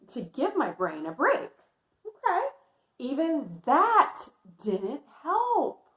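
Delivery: lively picture-book read-aloud voice; reading to children. Acted. Teacher reading Amelia's story to her class, lively.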